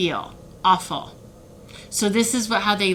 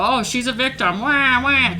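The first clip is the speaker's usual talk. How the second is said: mock whiny taunt; 5 semitones higher, 3 dB louder than usual. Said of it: "people to go".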